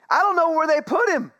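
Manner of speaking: Mary pleading high and loud at the tomb; high distraught cry. Retold or imitated. Mary wailing at the tomb, high and loud. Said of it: "she said".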